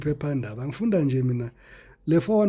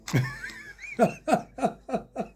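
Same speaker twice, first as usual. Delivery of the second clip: high breathy giggle; cracking up; giggling; 10 semitones higher than usual. Real, not acted. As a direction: giggle helplessly. Laughs at own joke.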